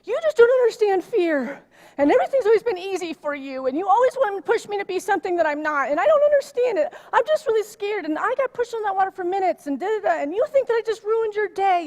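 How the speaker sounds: put-on high, loud, fast panicked rant of a scared teen; upset daughter yelling. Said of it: "she's like / she's screaming at me".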